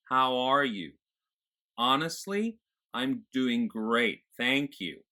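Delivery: bright-eyed small talk in an American accent, resonating behind the eyes. Shown as a demonstration. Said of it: "again, behind my eyes".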